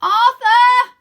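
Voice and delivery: high, loud urgent call; loud calling girl voice. A narrator's reading. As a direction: call up to her brother. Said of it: "Rose calls".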